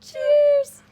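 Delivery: high, loud cheer; excited. Real, not acted. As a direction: call it out gleefully. Toasting with chamomile.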